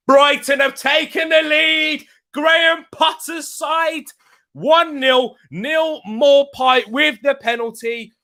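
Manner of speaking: high, drawn-out announcement of a goal; excited score call. Real, not acted. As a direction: call out the score high and slow, stretching the words. Calls out a live score update.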